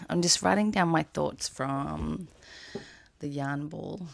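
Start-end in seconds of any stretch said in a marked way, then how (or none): none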